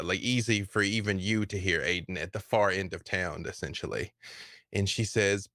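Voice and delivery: normal talking voice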